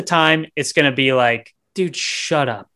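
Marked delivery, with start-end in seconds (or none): none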